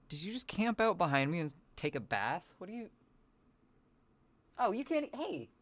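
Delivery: talking while wrestling a cat; strained, grappling with the cat; holding down a pet. Real, not acted. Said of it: "wrestling with my cat so much".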